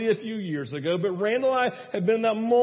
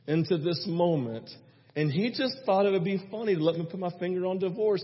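usual speaking voice

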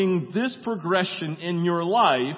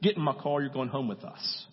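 quiet firm invitation; 5 semitones lower, 6 dB quieter than usual. Retold or imitated. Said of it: "she says".

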